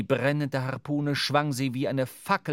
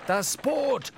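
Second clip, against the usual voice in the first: high, loud alarmed cry; shout; 12 semitones higher, 3 dB louder than usual. A narrator's reading. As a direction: scream the warning. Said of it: "schrie Starbuck". (hoher, alarmierter Schrei)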